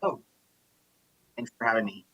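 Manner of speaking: ordinary talk, nothing marked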